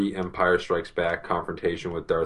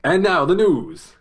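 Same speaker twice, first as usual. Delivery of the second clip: much higher, louder, slow announcer voice; grand old-timey news intro; 11 semitones higher, 7 dB louder than usual. Pretend. Mock news intro after joke.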